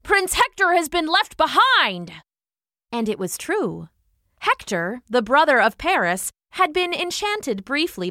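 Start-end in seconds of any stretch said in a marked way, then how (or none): 0.0-2.2 raised, high-pitched alert; excited cry. A narrator's reading. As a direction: spread the news urgently to a comrade. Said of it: "he cried".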